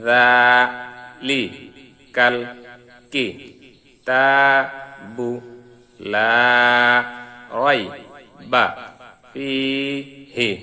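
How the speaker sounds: broken-up syllables, each one set apart by a pause; slow separated syllable drill. Shown as a demonstration. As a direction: say each syllable alone, then stop. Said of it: "এখন আমরা ভাঙ্গা ভাঙ্গা উচ্চারণ করব".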